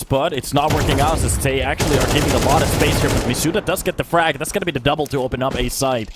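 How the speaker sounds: quick, rising esports commentary; building caster call; live play-by-play. Real, not acted. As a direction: call the round as it happens.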